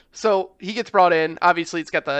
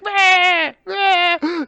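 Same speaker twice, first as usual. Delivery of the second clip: put-on much higher, whiny baby wail; imitated baby cry; 12 semitones higher, 4 dB louder than usual. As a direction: mimic the fake baby crying. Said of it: "a screaming baby".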